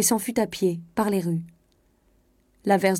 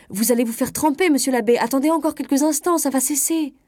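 raised, higher call after someone leaving; cries out; 7 semitones higher, 4 dB louder than usual. A narrator's reading. Marin calling after the departing priest, loud and high. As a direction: call out loudly to stop him. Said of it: "s'écria".

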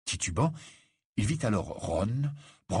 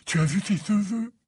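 high, dreamy rapture; lovestruck swooning; 12 semitones higher, 3 dB louder, slower than usual. A narrator's reading. Ron raves to Harry about her, swooning. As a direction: swoon over every detail in a much higher voice.

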